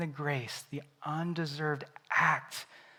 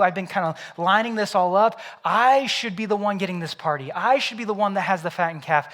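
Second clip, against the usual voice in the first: louder, higher, aggrieved protest; upset older son; 4 semitones higher, 9 dB louder than usual. Acted. Older son protesting to his father about the party, aggrieved. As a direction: vent every grievance at him.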